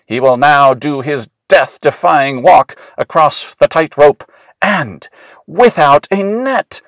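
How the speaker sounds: high, loud circus ringmaster announcing; pretend ringmaster. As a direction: boom it out to the whole big top. Willis plays circus ringmaster announcing.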